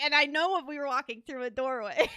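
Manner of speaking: normal talking voice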